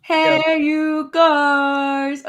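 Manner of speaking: hammed-up quote of a chatter's greeting; doing the accent. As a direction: show off the accent on request. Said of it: "I can't do the accent / I can".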